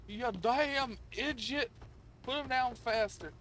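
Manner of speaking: slow, high, exasperated scolding; mock scolding. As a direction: scold the slow worker, stretching the words. Bossing a game worker around.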